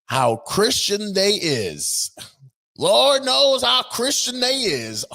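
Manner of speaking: slow, high, drawn-out mock preacher voice; mocking drawl. Commentator mocking Crockett's speech, slow and high. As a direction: stretch it out, hammed-up church lady.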